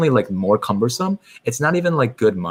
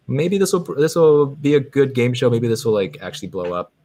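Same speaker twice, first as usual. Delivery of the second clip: high, louder burst of optimism; acted hopefulness; 5 semitones higher, 4 dB louder than usual. YouTuber recalling hopes for a game show, acted excitement. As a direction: voice big hopes, easing back down.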